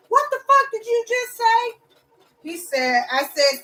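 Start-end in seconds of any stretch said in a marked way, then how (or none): 0.1-1.8 much higher, put-on voice of an angry old man; outraged old man. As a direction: snap back in disbelief as the neighbour. Old man's outraged reply.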